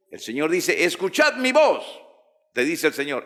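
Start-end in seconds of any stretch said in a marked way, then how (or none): 0.8-1.8 high, emphatic proclamation; raised, ringing preacher's quote; God's command. Acted.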